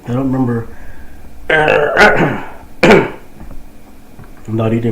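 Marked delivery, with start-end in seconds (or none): 0.7-4.4 sharp involuntary hiccup; a real hiccup jolting out. Real, not acted. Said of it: "I've been hiccuping nonstop".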